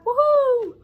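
high, loud whoop of joy; excited cheer. Acted. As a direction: cheer like a thrilled kid. Excited kids cheering on bus.

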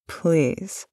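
ordinary talk

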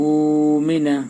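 normal talking voice

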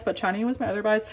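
ordinary talk

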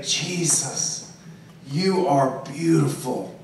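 soft, hushed awe at a vision; awed hush. Retold or imitated. Believer whispering to Jesus in a vision, awed and hushed. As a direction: breathe it out in wonder.

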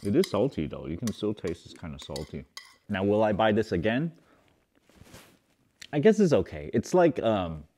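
speaking right after sipping; just sipped from spoon. Real, not acted. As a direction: lips wet after sip. Seen 1: sips liquid from spoon at -2 to -1, then speaks holding spoon.

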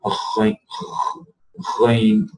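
gargle the ghain; gargled ghain. Shown as a demonstration. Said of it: "pronouncing the ghain".

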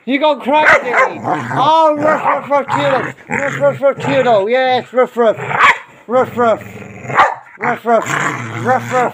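high sing-song "ruff, ruff" at the dog; playful mock barking. Real, not acted. Owner barking back at Tudor, high playful mock bark.

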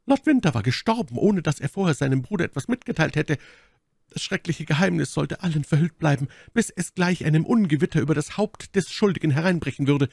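high, urgent lady's voice; Milady, eindringlich klagend. Acted. Milady tells her story. (Mylady, hohe klagende Frauenstimme)

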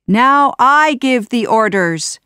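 high, loud, commanding; triumphant cry. A narrator's reading. Jafar, now a genie, takes command, crying out. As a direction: proclaim your new power. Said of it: "Jafar cried".

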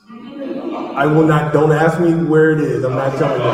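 loud, mock-stern refusal; emphatic refusal. Real, not acted. Author refusing to tell audience where the error is, loudly emphatic.

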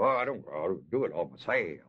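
a lightly voiced proud loner, low; brushing off help with mock pride; mock self-reliant boast. Preacher mimics a proud man refusing help, mock boast.

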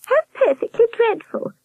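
very high horrified warble. A narrator's reading. Nurse reacting to Albert's news, horrified falsetto. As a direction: exclaim in high-pitched horror. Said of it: "in a horrified voice".

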